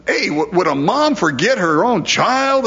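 high, incredulous question; questioning God. Retold or imitated. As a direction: ask it like it's unthinkable. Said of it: "God says".

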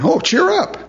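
high, sing-song fake encouragement; mock cheeriness. Preacher imitates once-hushing crowd now encouraging the beggar, mock cheery. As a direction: ham up a sudden change of tune. Mocks crowd's sudden change of tune.

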